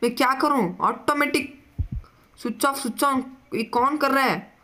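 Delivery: high, anxious rapid questions; spooked. Real, not acted. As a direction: freak out as lights flicker. Lights switching by themselves.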